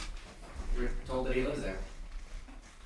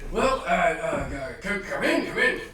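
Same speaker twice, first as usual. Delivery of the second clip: hesitant stammer rising into a louder invitation; higher, flustered host character; 6 semitones higher, 6 dB louder, slower than usual. Acted. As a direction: stumble over the welcome, then usher them in. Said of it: "He's like".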